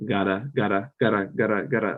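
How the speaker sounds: "gotta" with a quick tapped t between the vowels; flap like two T's. Shown as a demonstration. Teachers showing English speakers how to flap the Spanish R. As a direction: tap the t in "gotta" like a fast flap. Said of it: "pronounce it as if it's, like, two T's".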